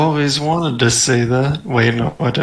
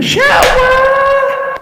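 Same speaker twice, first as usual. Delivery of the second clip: very high, slow loud shout; 3 dB louder than usual. Pretend. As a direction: belt it out like a cartoon. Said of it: "This is the sound you hear".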